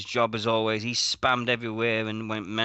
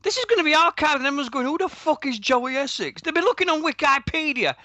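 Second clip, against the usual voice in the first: high-pitched, worked-up complaint; exasperated rant; 13 semitones higher, 3 dB louder than usual. Real, not acted. Host to co-host about baffled future listeners, high exasperated rant. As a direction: protest high and indignant at the derailed show.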